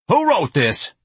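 high, loud demand; angry demand. A narrator's reading. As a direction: demand an answer sharply. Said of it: "Mister Levy demanded".

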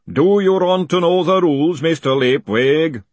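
loud, high booming call. A narrator's reading. Golem taunting a fugitive across the yard, booming. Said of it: "the voice boomed out".

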